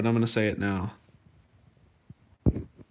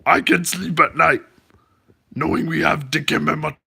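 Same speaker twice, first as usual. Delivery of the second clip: Dikembe Mutombo; 4 semitones higher than usual. Acted. Streamer reads a mean tweet in best basketball legend impersonation. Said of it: "I'll do it in my best impersonation".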